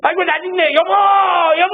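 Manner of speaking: high mock wail calling for his wife; old man wailing. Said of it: "계속 이래요". (아내를 부르며 높게 우는 할아버지 흉내)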